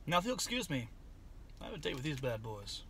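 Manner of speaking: usual speaking voice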